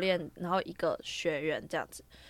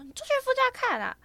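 high, pinched mimicry of the coach; squeaky caricature of the driving coach; mock coach voice. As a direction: ham up the coach's whiny insistence. Mimicking coach ordering her.

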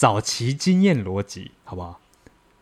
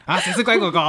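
talking to a dog; 8 semitones higher than usual. Pretend. Pretend petting a dog.

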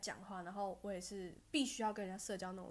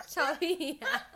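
laughing scold at herself; 7 semitones higher, 6 dB louder, much slower than usual. Real, not acted. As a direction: laugh while telling yourself off.

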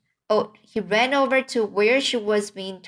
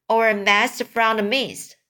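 usual speaking voice

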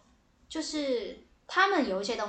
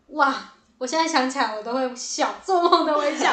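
excited gush; 5 semitones higher, 10 dB louder than usual. Real, not acted. Gushing about being selected.

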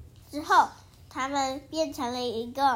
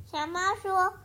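ordinary delivery, nothing marked

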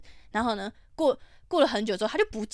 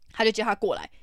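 usual speaking voice

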